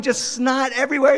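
ordinary talk, nothing marked

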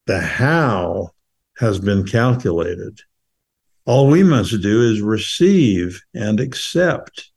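deliberate, spaced-out recital; measured verse, letting each line land; slow poem reading. Acted. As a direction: read each phrase slowly, pausing after it. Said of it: "try to read it slowly".

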